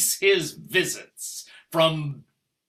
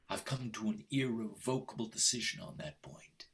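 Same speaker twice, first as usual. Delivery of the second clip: whisper; 7 semitones lower, 11 dB quieter, faster than usual. Acted. Cold marital ultimatum, hushed.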